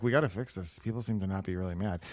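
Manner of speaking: soft, tentative worried executive; mild-mannered Tim Cook. Retold or imitated. Tim Cook raises a problem in a meeting, mildly, softly.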